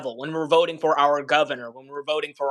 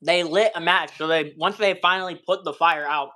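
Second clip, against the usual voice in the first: normal talking voice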